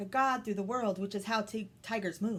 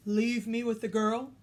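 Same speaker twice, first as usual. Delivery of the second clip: tiger voice; 3 semitones higher, 6 dB louder than usual. Acted. Tiger commands the family.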